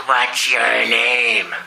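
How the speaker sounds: very slow, low, menacing demon; imitating the demon's slow question. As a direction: drag each word out like a possessing demon. Demon speaking through the man.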